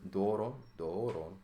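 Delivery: "doron" said slowly and carefully, twice, stress on the first syllable; teacher sounds out "doron" slowly; Greek word demo. Shown as a demonstration. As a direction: model "doron" for students to repeat. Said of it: "We say doron".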